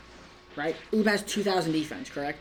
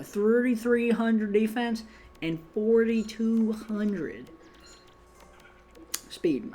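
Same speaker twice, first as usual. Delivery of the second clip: slow, higher-pitched Southern drawl; Southern accent; 4 semitones higher, much slower than usual. Real, not acted. Gamer reading out stats for viewers, accidental Southern accent. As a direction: slip into a drawn-out Southern accent. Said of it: "talking in a real Southern accent".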